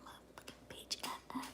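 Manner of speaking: whispered curse. Retold or imitated. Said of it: "I was like".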